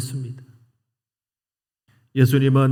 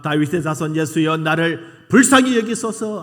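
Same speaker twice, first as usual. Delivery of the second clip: loud pleading cry; 4 semitones higher, 5 dB louder than usual. Retold or imitated. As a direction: cry out for mercy with all your voice. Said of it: "아마 크게 소리 질렀지 않겠습니까". (크게 외치는 간절한 부르짖음)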